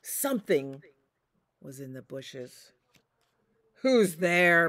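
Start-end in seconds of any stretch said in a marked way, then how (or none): 3.8-4.7 loud challenging call; a father wolf demanding an answer; growled. Acted. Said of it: "Big Wolf growled".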